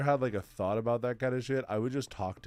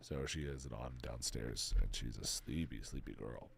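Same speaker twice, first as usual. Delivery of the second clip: soft sleepy tone; 10 semitones lower, 10 dB quieter than usual. Real, not acted. Talks softly about sleeping girlfriend.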